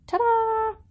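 high, drawn-out sung-out flourish; playful reveal. Pretend. Podcaster showing off the finished deodorant recipe, playful reveal. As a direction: present it with a long, high final note.

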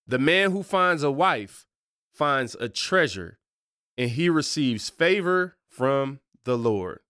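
slow, measured reading; scripture reading. Real, not acted. Host reading Proverbs to listeners, slow and measured. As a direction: give each phrase of the verse room.

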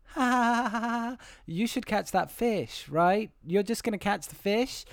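a lightly voiced sly woman, cackling; teasing woman. Voices fishing supplier woman teasing.

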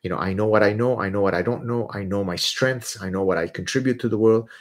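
usual speaking voice

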